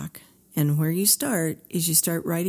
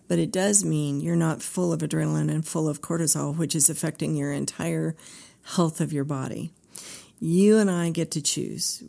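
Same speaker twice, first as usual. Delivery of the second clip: ordinary delivery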